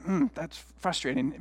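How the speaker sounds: privately stewing, very soft; frustrated muttering. Retold or imitated. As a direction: mutter it under your breath, mildly annoyed. Stewing silently over an insult.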